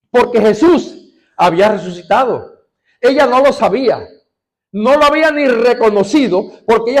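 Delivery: loud, high, fervent sermon delivery; preaching at full force. Acted. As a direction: proclaim it to the whole congregation.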